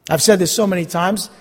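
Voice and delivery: usual speaking voice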